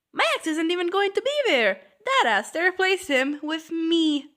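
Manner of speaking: high, loud, fast comic outburst; worked-up, high-pitched exclaiming; outraged disbelief. Acted. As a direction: blurt out the scandalous twist. Acted shocked rant at twist.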